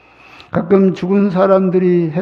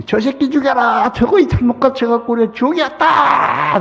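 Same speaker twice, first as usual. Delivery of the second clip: a touch higher mock angry shout; half-hearted furious crowd cry; lightly acted angry mob; mock mob yell; 8 semitones higher, 3 dB louder than usual. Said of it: "소리 지르더래".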